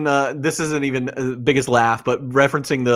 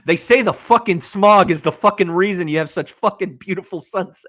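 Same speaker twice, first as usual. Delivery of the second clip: mock angry rant; 7 semitones higher, 5 dB louder than usual. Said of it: "but he says".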